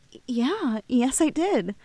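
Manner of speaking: flustered admission. Acted. Flustered admission of her feelings.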